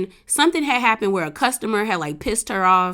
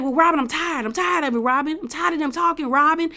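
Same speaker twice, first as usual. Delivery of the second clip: higher-pitched imitation of a fed-up husband; mock fed-up; 6 semitones higher than usual. Husband tired of the gossip complains to his wife, mock fed-up. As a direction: whine it in mock exasperation. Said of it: "he just got mad".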